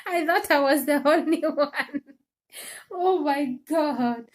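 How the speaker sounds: laughing mid-sentence. Real, not acted. Laughing as she confesses to her viewers. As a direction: let a laugh break in the middle.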